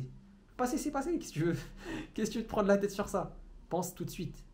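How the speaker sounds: high, light voice breaking into a laugh; amused exasperation. Real, not acted. Coach telling viewers to drop the past, laughing exasperation.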